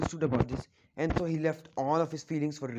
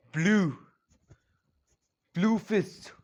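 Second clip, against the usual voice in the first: louder, higher battle cry of the move; shouted move name; 4 semitones higher, 4 dB louder, much slower than usual. A narrator's reading. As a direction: call out the attack name. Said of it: "he shouts".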